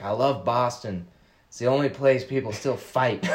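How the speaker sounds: light Boston accent impression; a hint of thick Boston accent. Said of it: "in his fr-, thick Boston accent".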